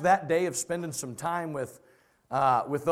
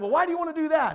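much higher, incredulous question; surprised question; 10 semitones higher, much faster than usual. Retold or imitated. Pastor asking a student why, higher in disbelief. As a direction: ask it in disbelief, pitched up. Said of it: "I said".

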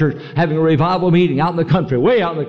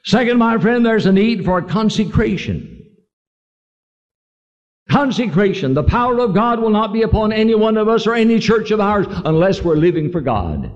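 higher, lifted sermon proclamation; rising preacher voice; 6 semitones higher than usual. Acted. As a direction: proclaim the need to the church. Sermon point raised.

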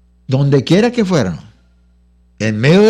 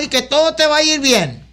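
high, sing-song mock of an easy promise; mocking prosperity preacher; 8 semitones higher than usual. Preacher mocking churches that promise everything goes well, high sing-song. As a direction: lift the pitch and parody the feel-good preacher. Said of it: "Ah, y es lo que queremos".

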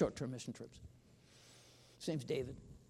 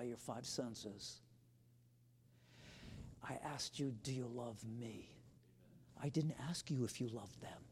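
tender voice of the Lord; 4 dB quieter than usual. Retold or imitated. The Lord speaks softly to the preacher. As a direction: gently ask as the Lord would. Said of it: "third verse came around".

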